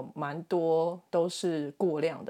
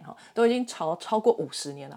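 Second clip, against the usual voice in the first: normal talking voice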